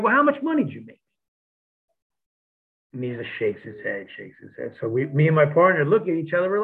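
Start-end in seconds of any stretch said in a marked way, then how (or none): 0.0-1.0 higher, prodding ask; needling question. Retold or imitated. Steve needling JBL about his pay, higher and prodding. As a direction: push him to admit it. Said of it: "so Steve's like".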